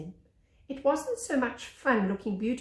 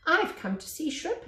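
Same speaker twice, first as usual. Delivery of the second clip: higher, grand, self-important announcement; most important voice; 8 semitones higher than usual. Acted. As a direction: announce yourself like royalty. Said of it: "in his most important voice".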